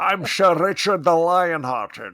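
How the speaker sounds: put-on loud, slow Scottish accent with slushy s; hammed-up Scottish star announcing himself; Sean Connery. Actor playing King Richard, grand slurred Scottish accent. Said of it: "to do my Sean Connery".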